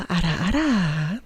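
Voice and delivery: slow teasing big-sister voice; teasing ara ara. Pretend. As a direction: tease them like a doting big sister. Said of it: "wanting these ara aras".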